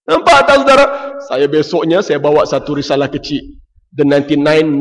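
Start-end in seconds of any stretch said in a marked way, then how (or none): none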